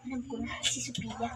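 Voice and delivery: very soft, low musing; hushed private thought; talking to oneself. Acted. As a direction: murmur a secret idea to yourself. Prisoner scheming to herself.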